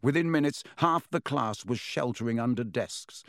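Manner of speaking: ordinary delivery, nothing marked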